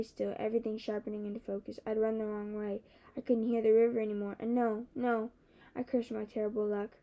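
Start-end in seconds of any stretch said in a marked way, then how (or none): none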